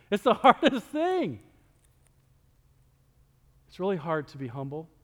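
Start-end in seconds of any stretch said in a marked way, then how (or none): 0.1-1.4 laughs mid-line, higher and brighter; laughing. Acted. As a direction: laugh through the complaint. Laughing complaint to God.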